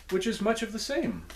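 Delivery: a real crack in the voice. Real, not acted. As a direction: voice cracks up high mid-phrase. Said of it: "pardon the voice crack".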